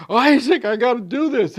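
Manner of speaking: loud, high pleading to his son; anguished Abraham. Retold or imitated. Abraham loudly pleading to Isaac before the sacrifice. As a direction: cry out as a torn father.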